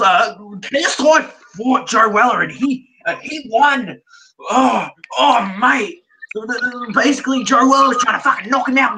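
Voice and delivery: high, hammed-up Australian commentator accent; over-the-top Australian accent. Mocking a YouTuber's commentary in an over-the-top Australian accent. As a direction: ham up an Aussie YouTuber narrating a fight. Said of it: "in an over-the-top Australian accent".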